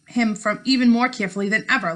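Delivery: ordinary delivery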